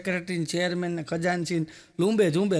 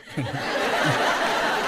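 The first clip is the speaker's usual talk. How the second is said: laugh; 8 semitones lower than usual. Real, not acted. Laughs after punchline.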